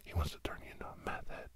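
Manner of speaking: normal talking voice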